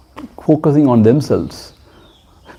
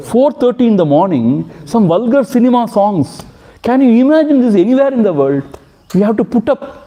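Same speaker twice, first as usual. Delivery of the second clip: indignant complaint; 7 semitones higher than usual. Real, not acted. Complaining about dawn songs.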